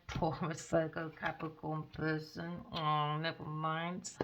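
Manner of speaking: slow, soft aw never mind; soft mock pity. Dismissing the signs with slow, soft fake pity.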